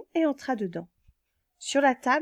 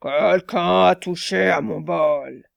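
low, slow, louder bear growl; growling big bear; 5 semitones lower, 5 dB louder, slower than usual. Acted. Big bear finding his bowl touched, low slow growl. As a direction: rumble it like a huge papa bear. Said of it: "Le grand ours gronda".

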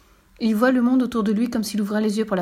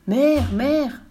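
high, loud call across the palace; calling mother; 5 semitones higher, 5 dB louder than usual. Acted. King calling his old mother, loud call.

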